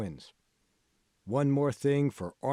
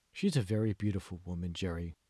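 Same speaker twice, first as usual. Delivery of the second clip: ordinary delivery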